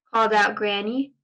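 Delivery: ordinary delivery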